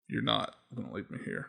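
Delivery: deadpan growl-free hero comeback; Master Chief line. Retold or imitated. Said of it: "and says".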